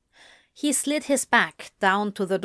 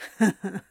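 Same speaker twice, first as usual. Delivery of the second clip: short bitter laugh; a dry laugh with no joy; a humourless huff of a laugh; mirthless laugh. A narrator's reading. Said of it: "laughed mirthlessly".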